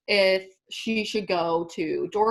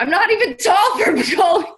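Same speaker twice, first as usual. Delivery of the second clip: loud, high laughing outburst; half-shouting through laughter; 11 semitones higher, 9 dB louder than usual. Real, not acted. Teasing about not being a goalie, laughing out loud.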